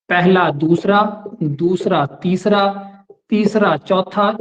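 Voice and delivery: slow, pair by pair, each pair set apart; slow spelled-out demo. Shown as a demonstration. Teacher demonstrating the word pairs to students, slow and deliberate. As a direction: lay out each pair slowly for the class. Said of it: "इस तरीके से शब्दों का जहां पे दोहराव हो रहा होगा".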